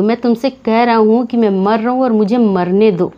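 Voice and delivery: normal talking voice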